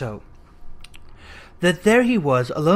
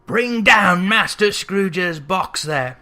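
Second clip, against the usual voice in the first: loud, slow booming schoolmaster; a stern master calling down the hall; hammed-up bellowing schoolmaster; terrible voice; 2 semitones higher, 5 dB louder, much slower than usual. A narrator's reading. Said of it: "A terrible voice in the hall cried".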